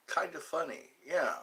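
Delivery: usual speaking voice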